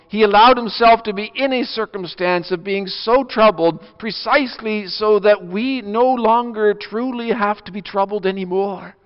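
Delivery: rising preaching. Acted. Preacher proclaiming the risen Lord to the congregation, rising. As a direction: build the sermon toward triumph.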